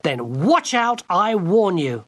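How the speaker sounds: high, slow warning; threatening. Acted. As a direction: threaten him. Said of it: "Gwendolen said".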